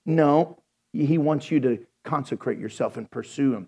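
ordinary talk, nothing marked

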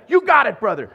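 loud, high cheering you got it brother; the eager church guy egging him on loudly; over-the-top charismatic cheerleader; hyped-up encouragement. Acted. Pushy charismatic coaching newcomer.

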